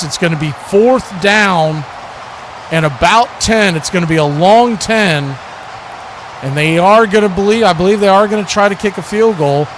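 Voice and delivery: normal talking voice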